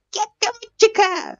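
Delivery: very high, slow squealed mock Japanese. Gamer doing mock Japanese exclamation, very high squeal. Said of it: "maybe some Japanese".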